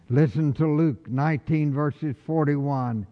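ordinary delivery

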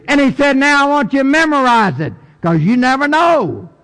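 high, driving preacher's declaration; impassioned preaching. Acted. Preacher declaring his faith to the congregation, impassioned. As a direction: proclaim it in a high, insistent pulpit voice.

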